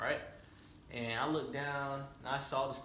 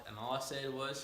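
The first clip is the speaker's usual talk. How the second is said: ordinary talk, nothing marked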